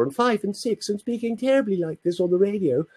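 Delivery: high, put-on small boy's voice; little child's voice. Shown as a demonstration. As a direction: imitate yourself as a tiny child on the radio. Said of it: "my little voice when I was four".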